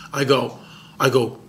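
usual speaking voice

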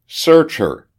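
high, loud called order; calling out. A narrator's reading. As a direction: call the order down the corridor. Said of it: "he called to a woman in the corridor".

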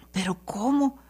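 whispered disbelief; susurro incrédulo. Pretend. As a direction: whisper it in amazement. Doubt repeated, trailing off. (susurrado, asombrado)

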